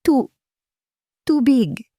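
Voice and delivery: high, strangled plea for water; choking gasp. A narrator's reading. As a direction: choke the word out, gasping. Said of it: "Isang tunog ng pagkakasakal". (nasasakal na paghingi ng tubig)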